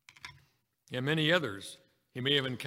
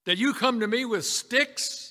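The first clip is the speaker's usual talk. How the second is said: loud, raised giant's challenge; booming giant; 8 semitones higher, 7 dB louder than usual. Acted. Giant scorning David's sticks as weapons, booming. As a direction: boom it out, rising to the end. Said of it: "this big giant with that booming voice".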